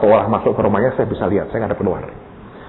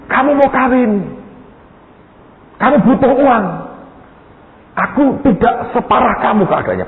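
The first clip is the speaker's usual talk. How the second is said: raised-pitch emphatic retort; insistent shouting; 13 semitones higher than usual. Retold or imitated. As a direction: press the point at the top of your voice. Acted insistence pressing money on friend.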